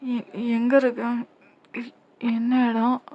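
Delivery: very slow, halting questions; acted dazed waking voice; groggy, dazed. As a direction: come round slowly, piecing the words together. Comes round dazed after fainting.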